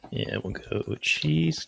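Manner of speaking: sniffly, runny nose. Real, not acted. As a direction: speak through a runny nose. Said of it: "Jeez, my nose is running".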